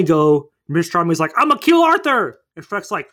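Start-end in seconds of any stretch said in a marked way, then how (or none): 1.4-2.4 high, loud mock yell; hammed-up threat, pitched way up; shouted villain threat. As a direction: bellow it like a cartoon villain.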